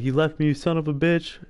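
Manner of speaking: high-pitched mock yell at a traitor; wounded man yelling at the leader who left him, imitated; retold angry yell. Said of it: "he was yelling at Dutch".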